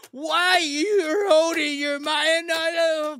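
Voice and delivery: drawn-out, high mocking wail; mock whine. Host jeering at a chat commenter, high mock crying. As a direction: wail it slowly as a jeer.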